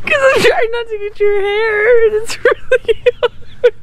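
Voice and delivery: high, loud words broken by giggles; laughing through words. Real, not acted. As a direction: fight back the giggles and keep talking. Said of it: "Will you stop laughing?".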